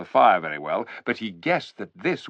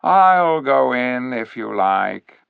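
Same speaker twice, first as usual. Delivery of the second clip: cool and clear; 6 dB louder, slower than usual. A narrator's reading. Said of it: "in a cool, clear voice".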